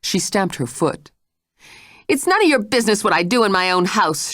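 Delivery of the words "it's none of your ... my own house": higher-pitched indignant outburst; angry cry. Acted. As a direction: throw it back at her angrily. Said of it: "she cried".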